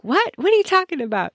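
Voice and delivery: high, puzzled mock confusion; retelling her own clueless what; baffled disbelief. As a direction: play dumb, clueless and high. Acting her own baffled reply.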